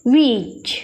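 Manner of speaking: बीज said alone, slow, with a clear final z; model word. Shown as a demonstration. Teacher models the word for students, clear z. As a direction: lay out बीज for students to copy. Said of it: "क्या पढ़ेंगे?".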